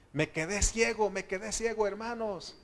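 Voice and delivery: high, loud panicked cry; frightened shout to the other believers; scared yell. Retold or imitated. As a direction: yell it like you've suddenly gone blind in the dark. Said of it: "él empezó a gritar, asustado".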